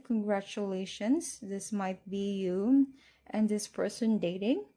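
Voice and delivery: ordinary delivery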